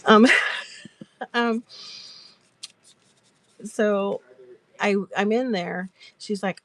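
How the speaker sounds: normal talking voice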